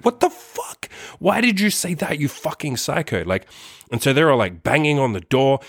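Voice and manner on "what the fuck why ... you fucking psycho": high squeal of disbelief, easing down by the end; incredulous retold outburst, pitched way up; outraged disbelief. Reacting to the stalker's lie from behind the door, high-pitched outrage.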